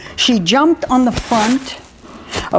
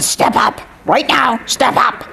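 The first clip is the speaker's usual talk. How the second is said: raspy parrot imitation; rough, voiceless croaked orders; bird's command; 3 semitones higher than usual. Lady's famous rallying cry to her owners, croaked. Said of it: "that famous role reverser conversation".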